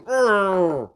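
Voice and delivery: high, put-on urgh; a cross little dinosaur groan; grumpy groan. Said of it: "She's going".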